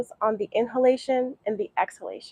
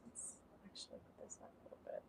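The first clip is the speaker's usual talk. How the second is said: whispered aside; 5 semitones lower, 32 dB quieter, much faster than usual. Real, not acted. Adjusting camera aside.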